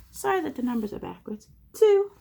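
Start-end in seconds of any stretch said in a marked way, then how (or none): 1.7-2.1 high, loud one-word reveal; sudden high teacherly announcement; excited answer. A narrator's reading. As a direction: call out the answer brightly to children.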